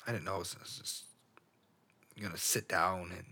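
very soft, halting admission; hushed and faltering, brutally honest; emotional confession. Real, not acted. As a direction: trail into quiet, broken restarts. Emotional, unplanned confession at microphone.

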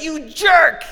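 high, loud mock scream; imitating a scream. Neighbor David screaming insults in the street, high mock yell. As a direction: shriek the insult like the angry neighbor. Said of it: "So he's screaming at me".

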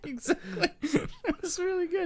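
giggling praise; laughing. Real, not acted. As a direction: speak while laughing hard. Laughing at partner's impression.

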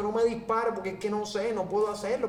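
high-pitched mock tearful begging; imitated crying plea. Man begging officer not to shoot, imitated crying. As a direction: plead as if crying, pitched well up. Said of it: "Y el tipo llorando".

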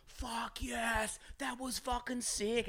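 high, hushed, drawn-out scream imitation; mock screaming. Passenger re-enacts climbing out of the car, soft high mock scream. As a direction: scream with the volume turned right down. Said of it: "I was still screaming".

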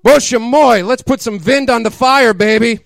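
high, loud hammed-up Russian accent; mock Russian accent. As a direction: ham up a Russian seductress accent. Linka voiced in Russian accent.

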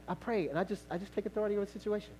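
usual speaking voice

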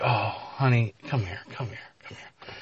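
hushed coaxing, fading toward a whisper; soft consoling. Acted. Professor consoling a woman who lost her job, soft coaxing. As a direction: draw them in softly and soothingly.

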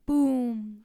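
loud sudden BOOM; booming knock. A narrator's reading. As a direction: hit it hard like a knock.

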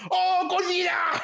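high, slow comic wail; mock panicked cry. Greeting a beagle named Godzilla, hammed-up terrified cry. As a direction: cry out as if the monster has arrived. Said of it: "every time it walks past".

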